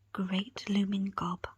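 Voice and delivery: ordinary delivery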